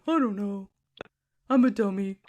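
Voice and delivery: a hint of a dopey, helpless boyfriend; mock dopey boyfriend. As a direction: lightly play him as a clueless dummy. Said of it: "that's what it always is with him".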